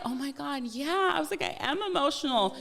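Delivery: high, excited agreement. Retold or imitated. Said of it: "And I was like".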